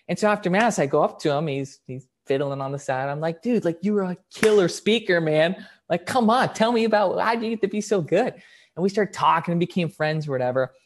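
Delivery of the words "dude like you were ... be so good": high, loud excited praise; gushing admiration. Retold or imitated. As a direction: pester him eagerly for his secret. Gushes to the speaker afterwards.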